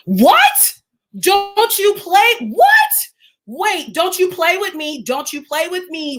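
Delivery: high, rising, stretched-out disbelief; stunned, pleading for it to be untrue; shocked disbelief. Real, not acted. Streamer reading chat about Kobe Bryant, shocked disbelief. Reads shocking news of a death.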